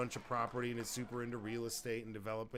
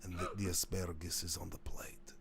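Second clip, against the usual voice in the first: unvoiced Russian-accent whisper; 5 semitones lower than usual. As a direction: whisper it like a secret. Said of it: "That's when I poached the accent".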